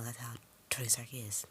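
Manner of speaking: usual speaking voice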